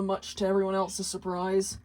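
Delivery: ordinary delivery, nothing marked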